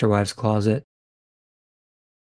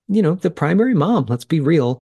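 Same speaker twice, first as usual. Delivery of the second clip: usual speaking voice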